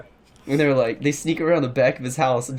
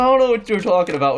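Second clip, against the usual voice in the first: imitated smirking denial, a little higher and brighter; suppressed giggle denial; 5 semitones higher, 4 dB louder, faster than usual. Prankster acting innocent on the phone, giggle in voice. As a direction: deny it with a giggle held in. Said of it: "a giggle in his fucking voice".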